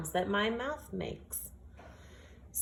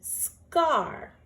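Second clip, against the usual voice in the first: proud announcement; 8 semitones higher than usual. Shown as a demonstration. Teacher proudly announces the finished word to the class. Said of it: "I'm gonna slide under my word to see".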